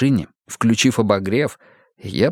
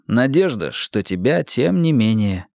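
usual speaking voice

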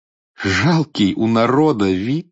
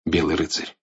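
usual speaking voice

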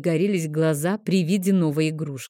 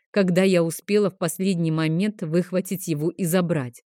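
usual speaking voice

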